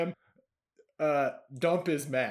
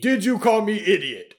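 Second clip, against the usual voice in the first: angry troll; 4 semitones higher, 8 dB louder than usual. Acted. Dump repeats angrily.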